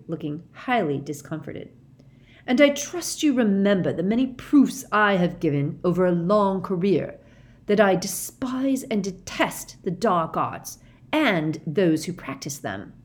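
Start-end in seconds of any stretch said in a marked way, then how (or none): 2.5-12.9 shouted. A narrator's reading. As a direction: spit out his hatred of the dark arts. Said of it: "Mister Crouch shouted".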